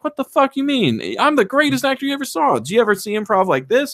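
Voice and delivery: high-pitched, indignant actor's rant; hammed-up outraged boasting; mock fury. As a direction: imitate an offended star blowing up. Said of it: "reveals himself in his fury".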